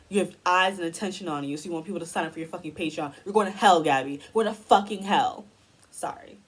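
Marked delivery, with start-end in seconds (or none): 3.2-5.4 loud, furious condemnation; frustrated outburst. Real, not acted. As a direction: shout it out in real frustration. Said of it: "I'm just so frustrated".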